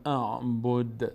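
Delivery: اعبد very slow with the final د bounced; slow recited اعبد with a rebounding dal; qalqala on dal. Shown as a demonstration. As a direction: let the د in اعبد echo with a bounce. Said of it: "این دال اگه اینجا ساکن شد".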